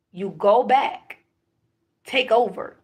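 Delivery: slow, loud leader's command; commanding order. Retold or imitated. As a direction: deliver it as a firm order from above. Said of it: "I was told by someone".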